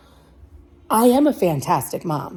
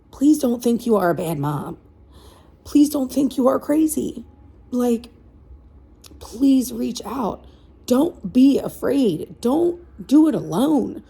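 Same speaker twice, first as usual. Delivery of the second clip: higher, slow, voice full of feeling; emotional pleading; 5 semitones higher than usual. Real, not acted. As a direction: beg them earnestly, on the edge of tears. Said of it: "I'm sorry that I was, you know, emotional".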